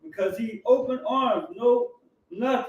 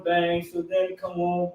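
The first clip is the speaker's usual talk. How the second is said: ordinary delivery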